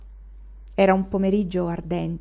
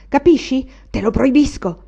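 loud, higher angry forbidding; raging threat, much louder; furious outburst; 6 semitones higher, 9 dB louder than usual. A narrator's reading. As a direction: lunge at him and explode. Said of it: "proruppe Anania andando addosso al Daga".

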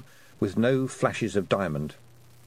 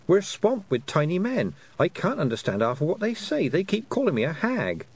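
a higher, eager girl's voice, lightly voiced; young girl Tiffany; 8 semitones higher than usual. Tiffany telling the toad her troubles, higher and eager. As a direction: sound like a flustered young girl.

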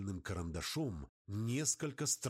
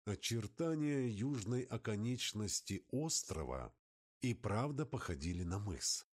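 plain reading voice

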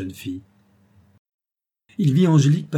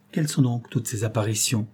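usual speaking voice

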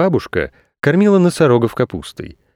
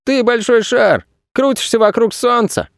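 put-on high-pitched child reciting; little boy's voice; 12 semitones higher than usual. A narrator's reading. Boy telling Earth what he remembers, childlike voice. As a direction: speak as a small boy, much higher.

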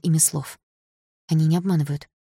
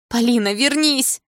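higher, drawn-out call; shouting after her; 7 semitones higher, 4 dB louder, slower than usual. A narrator's reading. Mother calling Polina back from the ambulance, shouting. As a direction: call her back urgently, stretching the words. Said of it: "закричала мама".

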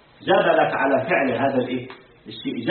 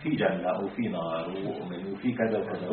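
lower, softer voice of an angel from the sky; 10 semitones lower, 10 dB quieter than usual. Pretend. Teacher voicing an imagined angel telling people of paradise, lower and softer. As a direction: speak down as an angel sent from heaven. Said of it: "لو نزل لك ملك هيك من السماء قال لك".

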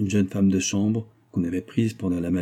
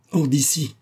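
raised-pitch cry of dismissal; shouted order; 6 semitones higher, 4 dB louder than usual. A narrator's reading. Mistress throwing the old servant out, crying out. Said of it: "cria madame Karsdorff".

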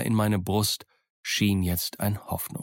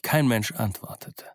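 usual speaking voice

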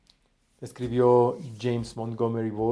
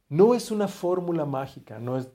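ordinary talk, nothing marked

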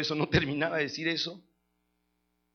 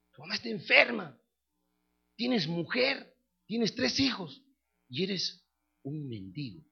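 padre que reprocha; 4 semitones higher, slower than usual. Retold or imitated. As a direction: lay out the accusations, then drop down heavily. (reproche agudo que cae en sentencia lenta y grave)

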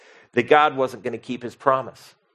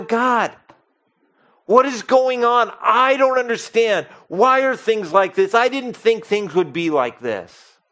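much higher, louder pleading to God; desperate outcry; 9 semitones higher, 4 dB louder than usual. Retold or imitated. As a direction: plead in bewildered pain. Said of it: "where you just go".

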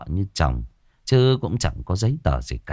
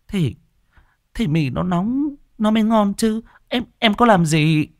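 frightened wife; 13 semitones higher than usual. Acted. Beaten wife answers, stammering.

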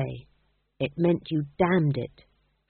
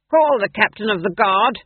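commanding duke; 3 semitones higher, 7 dB louder than usual. A narrator's reading. Mad duke gives orders.

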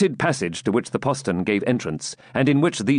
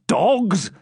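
high-pitched scornful cry; indignant commander's exclamation; angry rebuke; 10 semitones higher, 3 dB louder than usual. A narrator's reading. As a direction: hurl it as an insult at your men. Leader rebukes his fleeing men.

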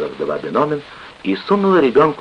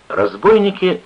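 ordinary delivery

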